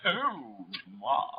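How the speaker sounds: low, drawn-out "oh my"; quick swoop, then sink deep and linger; low, savouring exclamation; George Takei. Acted. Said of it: "a clip of George Takei going".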